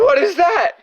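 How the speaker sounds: high, loud shrieking ask; shrieked question. Real, not acted. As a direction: shriek it in alarm. Repeats question, second far louder.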